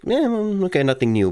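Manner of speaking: high, unimpressed mumble sliding down; dismissive shrug. Acted. Imagined viewer shrugging off the story, dismissive. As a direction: brush it off as old news. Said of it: "look at this story and just think".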